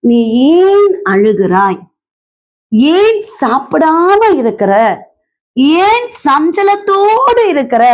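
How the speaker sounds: lightly voiced husband, high drawn-out questions; husband questioning wife. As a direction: ask it slowly, pitch lifted on the key words, as if imploring. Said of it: "தன்னுடைய மனைவியை பார்த்துதான் கேக்குறாங்க".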